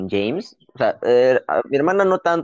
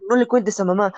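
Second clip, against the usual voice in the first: high hushed plea of a big brother; pleading older brother; 9 semitones higher than usual. Retold or imitated. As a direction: beg him not to tell mom, voice pitched up. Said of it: "el huevón lo que hacía era".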